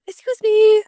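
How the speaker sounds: high, loud talking-dog voice. Acted. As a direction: speak up as the pet dog. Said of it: "He sounds like this".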